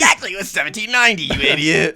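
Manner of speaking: high, loud, fast know-it-all; snooty pedant correcting; condescending correction. Retold or imitated. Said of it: "He's like".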